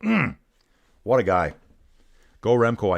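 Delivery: ordinary talk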